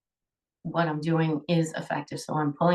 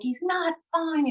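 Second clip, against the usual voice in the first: high, drawn-out protest without much volume; mock scream of contradiction, slow and high; retold scream; 9 semitones higher than usual. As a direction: imitate screaming back at the doctor. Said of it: "I'm screaming".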